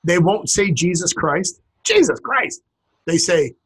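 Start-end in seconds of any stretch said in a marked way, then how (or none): 1.8-2.6 high, startled exclamation; exclaimed oath. Acted. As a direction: blurt it out as a shocked curse. Acting out surprised oath.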